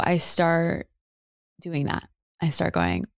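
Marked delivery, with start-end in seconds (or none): none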